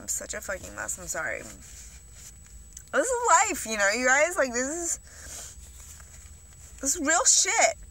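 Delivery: choked up, pitch rising and dipping; really emotional, apologizing as the voice climbs high; getting emotional. Real, not acted. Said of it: "I'm sorry, I'm gonna get emotional".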